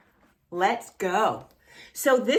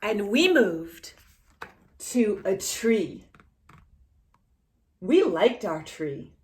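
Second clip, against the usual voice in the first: put-on reading to children. Reading the picture book aloud to a child, gentle.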